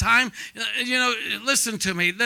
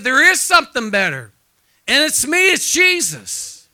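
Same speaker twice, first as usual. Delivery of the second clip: emphatic preached quote; 2 semitones higher, 8 dB louder than usual. Retold or imitated. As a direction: proclaim it like the voice of Jesus, slowing on the last words. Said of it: "I'm telling you, he said, he said".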